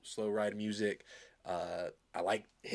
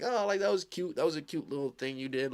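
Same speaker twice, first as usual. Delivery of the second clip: higher-pitched, patronizing "that was cute"; lightly belittling imagined comeback; mock downplaying; 6 semitones higher than usual. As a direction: play it down like it's just cute. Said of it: "downplay all of this".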